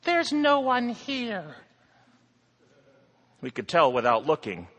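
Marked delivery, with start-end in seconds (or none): 0.0-1.5 high, frightened voice behind a door; fearful high voice. Acted. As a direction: call out scared from hiding. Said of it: "said a muffled voice".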